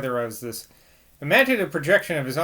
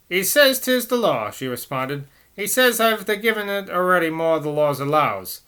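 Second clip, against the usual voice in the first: higher, urgent Cockney nurse; 3 semitones higher, 3 dB louder than usual. Acted. Nurse explaining the doctor's rule to the priest, urgent Cockney. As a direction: rattle it off in Cockney. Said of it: "I'm gonna have to do some Cockney".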